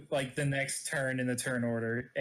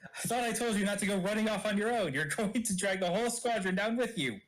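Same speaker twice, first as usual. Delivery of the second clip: higher-pitched scolding yell, not full volume; mild yell; 6 semitones higher than usual. Acted. Yelling at Roy for running off on his own, mild yell. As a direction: yell it in character with raised pitch.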